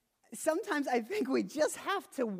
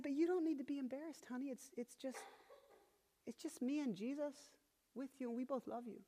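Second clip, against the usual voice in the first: soft, higher-pitched parental soothing; tender reassurance; 5 semitones higher, 9 dB quieter than usual. Retold or imitated. Father comforts his embarrassed praying daughter, tender reassurance. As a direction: gently reassure a small child.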